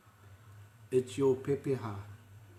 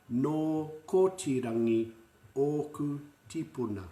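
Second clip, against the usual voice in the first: "No kotirangi oku tipuna" drawn out slowly for repeating; slow model phrase; 2 semitones higher than usual. Shown as a demonstration. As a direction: lay out the phrase word by word. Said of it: "say it after me".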